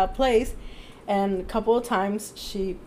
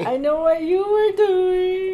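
suspicious neighbor voice; 8 semitones higher, 4 dB louder, slower than usual. Retold or imitated. Voicing the neighbor's look.

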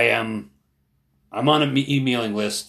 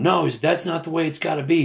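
raised pitch, imitating an urgent objection; retold heated argument; 7 semitones higher, 4 dB louder than usual. Younger man confronting older protester, imitated heated pleading. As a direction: argue back as in a retold quarrel.

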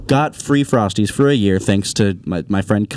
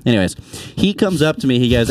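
normal talking voice

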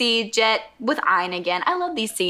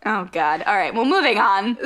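usual speaking voice

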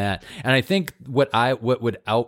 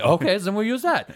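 put-on much higher, sweet voice to a small child; sing-song nanny voice; 11 semitones higher than usual. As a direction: coo it like a fake au pair. Fake nanny talking to child.

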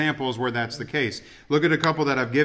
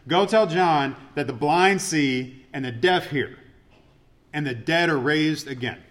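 slow scripture quote; slower than usual. Retold or imitated. Reading Jesus' words slowly.

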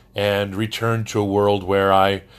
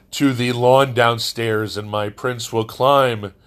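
normal talking voice

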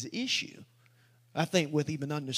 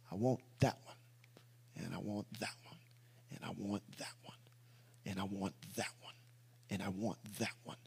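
very soft, low near-whisper; hushed choosing; 7 semitones lower, 13 dB quieter than usual. Acted. Shepherd choosing the sheep one by one, hushed near-whisper. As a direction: murmur it low, picking each one out in turn.

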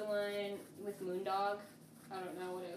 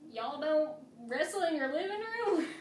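incredulous question in a put-on Southern dialect; mock dialect; 9 semitones higher, 9 dB louder than usual. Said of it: "fucking dialect".

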